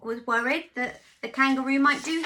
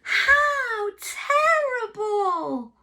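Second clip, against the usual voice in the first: very slow, loud, drawn-out dismay; hammed-up farmyard gasp of dismay; dismayed chorus; 9 semitones higher, 7 dB louder, much slower than usual. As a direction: stretch it out in mock horror. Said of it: "said everyone".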